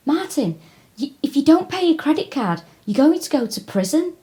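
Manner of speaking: high-pitched worried mum warning her son; upset mum. Retold or imitated. Mum warning her son about unpaid credit card debt, high worried voice. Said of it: "his mum was really upset".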